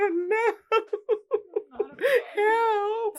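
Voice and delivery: high crying-laugh pleas; hammed-up helpless cry; mock helpless whimper. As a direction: play pitiful and helpless. Pleading to the cop.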